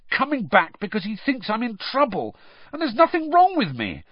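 very high, angry protest; furious. A narrator's reading. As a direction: protest angrily, pitch climbing. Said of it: "Harry said furiously".

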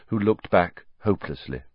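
ordinary delivery, nothing marked

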